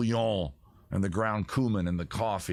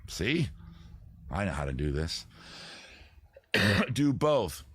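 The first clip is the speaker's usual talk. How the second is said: usual speaking voice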